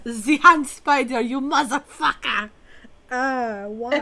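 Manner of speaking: loud laughing curse; screaming at a spider. Real, not acted. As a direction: yell it, half laughing. Cursing the spider.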